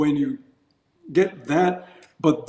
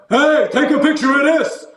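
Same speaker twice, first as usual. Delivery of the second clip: much higher, louder brash tourist; 10 semitones higher, 5 dB louder than usual. Retold or imitated. Mocking noisy American tourists abroad, high and loud. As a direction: play the obnoxious tourist. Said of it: "don't be like".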